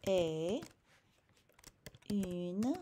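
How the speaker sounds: Madame est une prof américaine, word by word; slow French. Shown as a demonstration. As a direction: model each word with long pauses. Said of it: "So here's what I would say".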